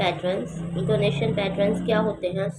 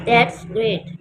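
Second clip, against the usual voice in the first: louder that's great with a fall; falling exclamation; 3 semitones higher, 6 dB louder than usual. Shown as a demonstration. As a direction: exclaim it and let it drop. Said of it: "statement में भी हम ऐसे ही करेंगे".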